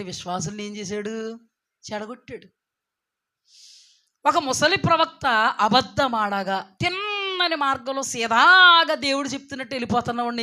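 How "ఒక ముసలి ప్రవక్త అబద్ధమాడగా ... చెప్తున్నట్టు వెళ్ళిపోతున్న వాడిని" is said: loud, emphatic retelling; booming sermon delivery with stretched words; full-force preaching. Real, not acted. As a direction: drive the story home loudly.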